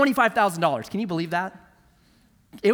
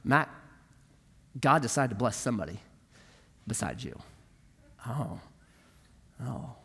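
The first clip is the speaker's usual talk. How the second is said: quiet recollection; 5 semitones lower, 6 dB quieter than usual. Retold or imitated. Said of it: "I've never forgot that".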